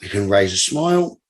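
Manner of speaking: low, unhurried relaxation guide with long pauses; guided meditation. Acted. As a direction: lead them through breathing, measured and deliberate. Leads a breathing meditation.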